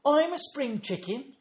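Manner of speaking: put-on high, slow, loud child voice; imitated small child's gleeful claim; excited little granddaughter. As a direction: announce it proudly like a toddler. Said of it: "she says, and started flapping her arms".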